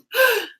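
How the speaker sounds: high, loud gasp; shocked gasp. Retold or imitated. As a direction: gasp as if caught out. Acts out shocked reaction.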